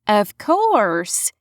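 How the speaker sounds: a thicker Southern twang; twangier Southern accent. A narrator's reading. Amber agrees to the receptionist in thick Southern twang. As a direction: lean harder into the Southern twang. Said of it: "the inexplicable Southern accent getting even twangier".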